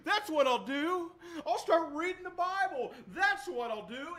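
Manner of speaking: high, breezy promise to do better; half-hearted resolve. Retold or imitated. Sinner making an easy vow, breezy and high. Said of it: "I'm like".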